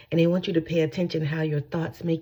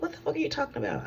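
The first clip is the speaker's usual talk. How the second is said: high, soft and rushed; quick hushed squeak of disbelief; retold incredulous retort; 11 semitones higher, 6 dB quieter, faster than usual.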